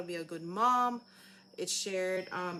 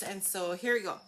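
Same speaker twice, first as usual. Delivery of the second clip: ordinary talk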